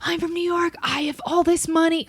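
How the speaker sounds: an outraged New York banker in a high put-on voice; New York accent. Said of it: "the guy's like".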